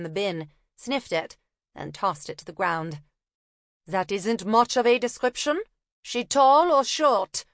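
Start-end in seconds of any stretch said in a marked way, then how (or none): 3.9-7.5 put-on German accent. Una teasing the copper in a German accent. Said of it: "feigning a German accent".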